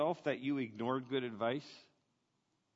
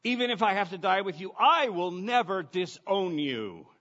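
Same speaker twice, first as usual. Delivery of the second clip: louder and higher, pressing every word; emphatic insistence; 5 semitones higher, 6 dB louder than usual. Retold or imitated. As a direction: insist hard, refusing to back down. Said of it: "Peter insisted emphatically".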